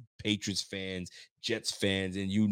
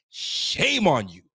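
mock scolding; 5 semitones higher, 4 dB louder, much slower than usual. Real, not acted. Scolding the analyst.

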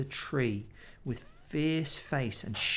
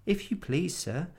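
trembling; 7 semitones higher than usual. Acted. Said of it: "trembling so that he could hardly speak".

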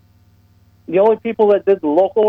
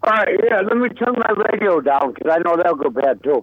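distant, muffled caller on a bad line; clipped phone line. Real, not acted. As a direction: talk through a crackly phone. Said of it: "I can barely hear you".

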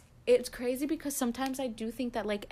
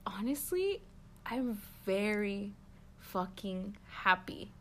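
slow, hushed, deliberate admission; retold sincere answer, starting near a whisper; heartfelt confession; 2 semitones lower, 6 dB quieter, much slower than usual. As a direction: let each word land slowly and softly. Retelling her solemn, heartfelt answer.

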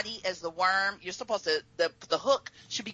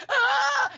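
high yelp of fright; scream; 5 dB louder than usual. Retold or imitated. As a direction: shriek in panic. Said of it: "And I'm like".

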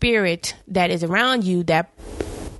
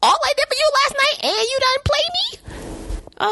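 high, put-on whiny man; mocking whine. Boyfriend complaining about being played, high mock whine.

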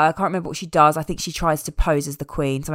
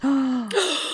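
mock gasp; 8 semitones higher than usual. Mock shock at theft.

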